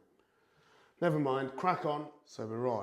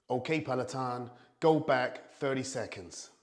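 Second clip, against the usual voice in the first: a loud, hammed-up fake American accent, slowed down; terrible American accent; 3 semitones higher, 9 dB louder than usual. Command to the Peloton after it ignores him, bad American accent. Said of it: "That was a terrible American accent".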